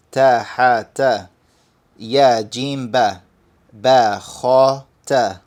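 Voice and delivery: slow, measured recitation of Arabic letters; letter-name drill recitation. Shown as a demonstration. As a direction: read each letter name out deliberately, evenly spaced.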